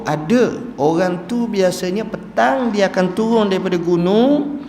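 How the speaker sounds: high, lively storytelling voice, partly slowed; shepherd eagerly explains the weeping man's routine. Retold or imitated. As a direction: tell it eagerly like a herdsman who knows. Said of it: "Dia kata".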